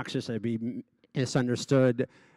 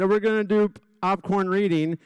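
lightly voiced teacher, pitched higher; a mild mock classroom announcement, raised pitch; teacher announcing; 8 semitones higher, 4 dB louder than usual. Teacher's announcement acted in story.

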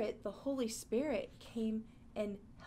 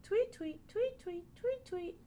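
high chirpy tweets rising; birdie tweets; 10 semitones higher than usual. Pretend. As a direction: chirp like a little bird. Teacher imitates a bird.

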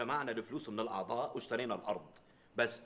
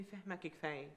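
quiet coy wife; 3 semitones higher, 9 dB quieter than usual. Retold or imitated. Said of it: "بتقول لي".

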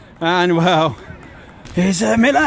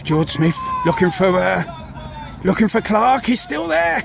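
ordinary talk

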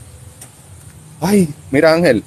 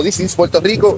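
ordinary talk, nothing marked

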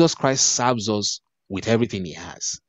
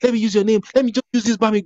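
put-on pleading child's voice pitched much higher and louder; mock begging child; 9 semitones higher, 4 dB louder, faster than usual. Son begging his father to use his name, mock pleading. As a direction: beg daddy like a whiny kid. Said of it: "Now I have to say".

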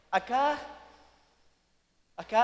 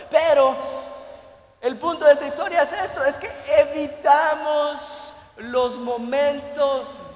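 louder, emphatic preaching; preaching at full force; 5 dB louder than usual. Acted. Pastor urging the congregation about pain, full-force preaching. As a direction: drive the point home to the church.

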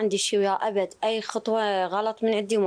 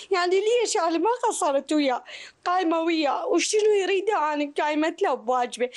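angry crying; 7 semitones higher than usual. Real, not acted. Mother grieving son, raised voice.

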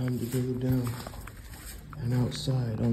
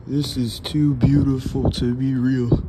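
louder burst of delight; thrilled exclamation; excited; 2 semitones higher, 9 dB louder than usual. Real, not acted. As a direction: gush over the view. Awed by the old building.

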